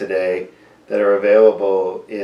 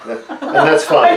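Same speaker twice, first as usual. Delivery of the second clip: laughing; 7 semitones higher, 6 dB louder, slower than usual. Real, not acted. Laughs at patient taking nothing.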